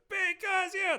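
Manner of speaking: distant high-pitched call; high octave off mic. Shown as a demonstration. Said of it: "I backed off the mic and I went up to my higher".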